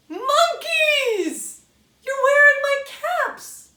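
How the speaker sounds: high, loud, laughing outcry of discovery; surprised outcry. Acted. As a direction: cry out in disbelief at the monkeys. Peddler spots monkeys in tree.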